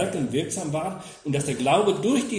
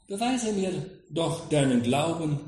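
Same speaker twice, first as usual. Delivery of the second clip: ordinary talk, nothing marked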